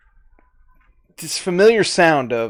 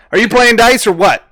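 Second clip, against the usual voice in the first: louder, higher challenge; impatient gambler; 8 semitones higher, 7 dB louder than usual. Acted. Gambler to new recruit at dice table, loud impatient challenge. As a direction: demand an answer from the newcomer.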